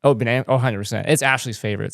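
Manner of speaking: ordinary delivery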